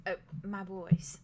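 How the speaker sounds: slow and tender; fond. Acted. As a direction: say it like a proud mother. [name] speaks of her twins.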